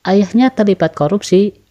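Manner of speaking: "Ayahnya terlibat korupsi" high and loud, as a yes-no question; rising question intonation. Shown as a demonstration. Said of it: "contoh intonasi naik".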